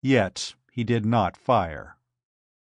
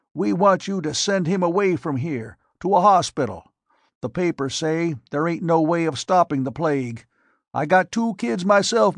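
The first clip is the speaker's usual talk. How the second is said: put-on raised, higher pleading old countryman; pleading old farmer; 6 semitones higher than usual. Old spokesman pleading with Court, raised and higher. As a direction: beg him like a worried father of two.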